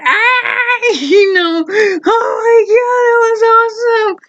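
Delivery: high, amazed, laughing; excited friend. Retold or imitated. Friend on his back, cheering the fight, high and thrilled. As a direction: squeal it like he can't believe it. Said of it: "he's going".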